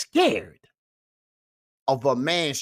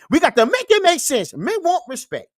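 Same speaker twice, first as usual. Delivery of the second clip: preachy shout; 12 semitones higher, 6 dB louder than usual. Acted. Catchphrase shouted to viewers.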